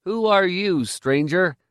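high-pitched, important challenge; self-important. A narrator's reading. Boy of ten challenges the stranger, self-important. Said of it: "said importantly".

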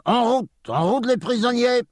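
French sergeant bawling the march order. Acted. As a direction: bellow the prisoners into line. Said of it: "the sergeant began to bawl".

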